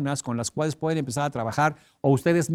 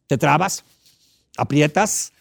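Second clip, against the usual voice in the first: normal talking voice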